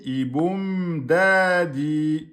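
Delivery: \"boum\" held a beat and a half, \"da\" long and loud; recitation with timed lengths. Shown as a demonstration. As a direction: count the lengths: \"boum\" one and a half beats, \"da\" two. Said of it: "un temps et demi".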